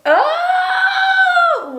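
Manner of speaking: sudden high laughing yelp; startled squeal; surprised shout. Real, not acted. Surprised by product running out.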